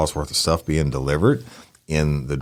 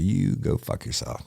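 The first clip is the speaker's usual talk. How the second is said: cold telling off; 7 dB quieter, slower than usual. Retold or imitated. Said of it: "I said".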